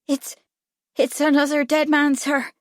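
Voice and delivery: put-on high, hesitant boy's voice; small voice. A narrator's reading. Boy breaking news of a death, small voice. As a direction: stammer it out like a nervous lad. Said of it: "he said in a small voice".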